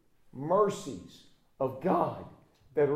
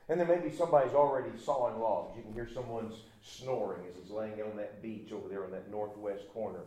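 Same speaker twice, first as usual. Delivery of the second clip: ordinary talk, nothing marked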